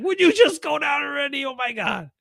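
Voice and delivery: high, pleading outcry; exasperated fan. Acted. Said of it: "every Packer fan right there ... was like".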